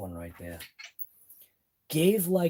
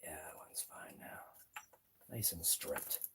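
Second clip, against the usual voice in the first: whisper; 9 semitones lower, 19 dB quieter, slower than usual. Real, not acted. Quiet muttering over a screw.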